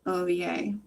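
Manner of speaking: ordinary delivery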